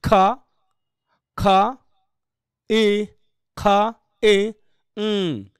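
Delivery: "খ", "ই", "উম" as separate sounds; IPA sound demo. Shown as a demonstration. Teacher breaks the word into IPA sounds, slow demo. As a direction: sound out "খ" with a puff, each piece apart. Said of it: "এটা হবে কি".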